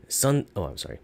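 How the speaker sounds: usual speaking voice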